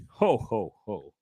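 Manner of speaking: low, weary ho ho ho; tired Santa. Acted. A worn-out Santa complaining, low and weary. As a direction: sigh it like a washed-up comic.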